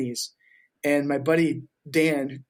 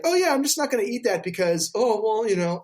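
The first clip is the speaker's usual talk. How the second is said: mock dieter; 7 semitones higher than usual. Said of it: "decide, like".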